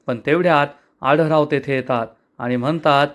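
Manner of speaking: ordinary delivery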